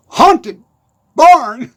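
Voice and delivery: "Haunted barn" loud, word by word with a pause between; careful tongue-twister. Shown as a demonstration. Attempting the hard words "Haunted barn", carefully separated. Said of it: "That's hard to say".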